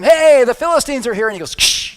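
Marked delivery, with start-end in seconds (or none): 0.0-1.3 a high, loud alarm call; yelling warning. Retold or imitated. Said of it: "she yells at him". 1.5-2.0 a short hissed "ksh" of cords snapping; snapping sound effect. Retold or imitated. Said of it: "just like that".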